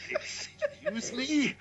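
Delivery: high, stretched-out incredulous question; hammed-up indignant double take; mock disbelief. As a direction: act affronted, half laughing, voice shooting way up. Acted indignant reply to the insult.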